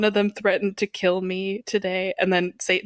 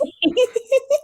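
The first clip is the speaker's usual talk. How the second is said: loud squealing laughter; loud high laugh; 5 dB louder than usual. Real, not acted. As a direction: crack up loudly. Laughing at Rotten Tomatoes joke.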